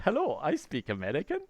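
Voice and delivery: failed American accent that comes out Indian; put-on accent, landing in Indian English; Indian-sounding accent. Shown as a demonstration. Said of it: "sounds like you're from India".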